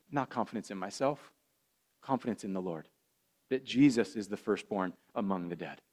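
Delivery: a quiet, sincere pastoral hush; tender. Acted. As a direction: speak gently of hope beyond death. Tender close about dying father-in-law.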